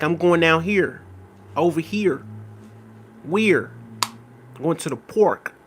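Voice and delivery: Los Angeles drawl with heavy R's; imitated L.A. accent, R's emphasized. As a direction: lean on every R, Los Angeles style, slowly. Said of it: "they put a lot of emphasis on their R's".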